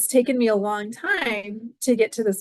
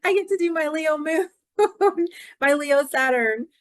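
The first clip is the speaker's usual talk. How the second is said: much higher, squealing with delight and a laugh; giddy excitement; 7 semitones higher than usual. Retold or imitated. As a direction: play her past self thrilled like a kid. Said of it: "I'm like".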